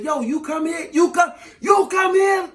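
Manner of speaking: high, building mock shout; yelling over music. Man repeating himself to her, building club yell. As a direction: holler to be heard in a club. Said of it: "You gotta yell when you're in a club".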